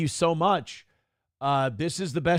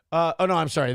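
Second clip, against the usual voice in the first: ordinary delivery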